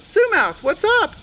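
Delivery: high, loud cartoon mouse; chirpy mouse asking what's wrong; Mister Mouse.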